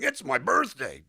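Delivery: big, loud, drawn-out stage delivery; amplified, stagey. Shown as a demonstration. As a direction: belt it out slowly, far too big for film. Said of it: "we have to learn to amplify it".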